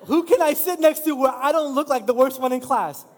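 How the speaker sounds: anxious self-mocking thought. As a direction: play up the fear of being the worst in class. Gym newcomer picking where to sit.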